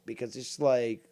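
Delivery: ordinary talk, nothing marked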